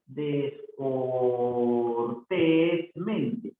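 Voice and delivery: "Descortésmente" very slow, almost chanted; syllable by syllable. Shown as a demonstration. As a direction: stretch each syllable of "Descortésmente". Teacher sounds out word for accent. (sílaba por sílaba, casi cantado)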